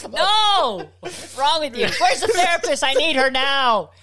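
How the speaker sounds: high, loud, laughing cries of disgust; laughing mock horror. Real, not acted. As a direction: shriek through the laughter at yourself. Horrified at own joke.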